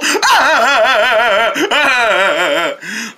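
gleeful mocking laughter; long cackling laugh. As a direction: laugh at their fright.

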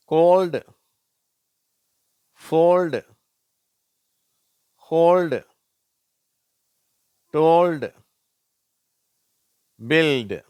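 "கோல்டு, ஃபோல்டு, ஹோல்டு, டோல்டு, பில்டு" loud, high, ending in "ldu"; LD sound demo. Shown as a demonstration. Teacher drilling LD, "கோல்டு, ஃபோல்டு, ஹோல்டு, டோல்டு, பில்டு" projected with l and d. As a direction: separate model words "கோல்டு, ஃபோல்டு, ஹோல்டு, டோல்டு, பில்டு", long pauses. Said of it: "இல்டு அப்படிங்கிற உச்சரிப்பை தருகிறது".

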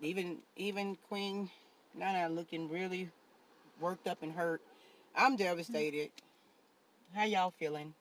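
low and soft, dragging out fake grief; mock sadness. Vlogger building fake bad news, hushed and somber. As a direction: sell the tragedy before the reveal. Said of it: "that was an act".

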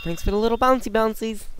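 high, mocking sweetness; sarcastic singsong thanks. Real, not acted.

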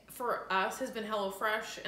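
usual speaking voice

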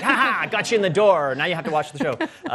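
gloating. Retold or imitated. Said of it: "and I'm like".

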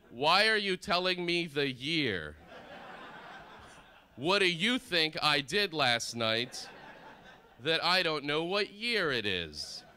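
slow, high, exasperated questioning; loud comic outrage, dragging out the words; mock indignant disbelief. Guest reacting to a strange wake-up call, indignant.

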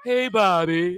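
imitating the dad. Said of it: "exactly how he talked".

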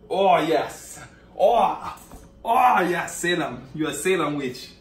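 loud, high mock-ecstatic cheer; hammed-up admiration. Stylist hyping client as Salem witch, hammed-up admiration. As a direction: gush over it at full volume.

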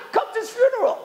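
high-pitched imploring cry; pleading. Retold or imitated. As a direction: beg urgently, acting out a bereaved caller. Mourners beg rabbi for eulogy.

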